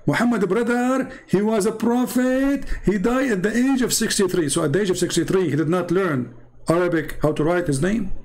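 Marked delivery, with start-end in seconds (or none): 0.1-4.5 imitated believer. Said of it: "they say about Muhammad".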